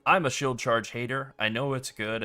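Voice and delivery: ordinary delivery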